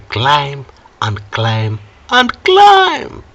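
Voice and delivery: stretched final word leaping way up; climbing pitch. Shown as a demonstration. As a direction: let the voice rise with each repeat. Acts out prices climbing ever higher.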